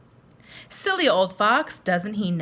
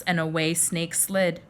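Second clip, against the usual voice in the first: usual speaking voice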